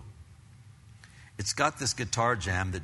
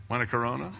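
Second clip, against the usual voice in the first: tempting friend; much faster than usual. Pretend. Acted offer of beer.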